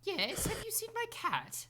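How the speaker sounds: put-on high, swaying, confused old lady; dotty absent-minded old lady. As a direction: ask sweetly, lost in thought. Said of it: "As she kinda, like, sways".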